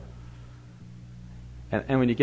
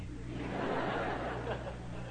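breathy chuckle; 11 semitones higher, 14 dB quieter than usual. Real, not acted. Chuckle after the punchline.

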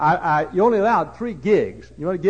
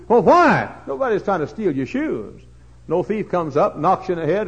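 usual speaking voice

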